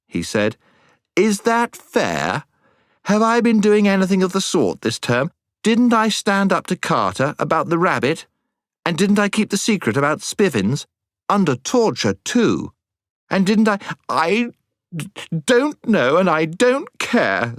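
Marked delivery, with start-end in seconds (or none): 1.1-13.8 high, aggrieved self-defence; indignant protest. A narrator's reading. Boy defending himself against Jill's unfair accusation, indignant. 14.1-17.5 high, tearful stammer; sobbing. A narrator's reading. Upset Jill cutting off the boy's defence, sobbing. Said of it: "sobbed Jill".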